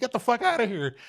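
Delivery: very high, loud incredulous cry; stunned disbelief. Retold or imitated. To the filmmaker behind him, a high shocked exclamation. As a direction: squeal it out in shock.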